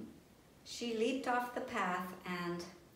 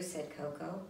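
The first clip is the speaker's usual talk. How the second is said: usual speaking voice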